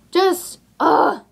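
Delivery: sigh, then a high frustrated groan; exasperated groan. Retold or imitated. Exasperated recalling her breaking point.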